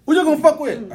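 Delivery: put-on high, loud Jamaican accent. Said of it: "Jamaican accent".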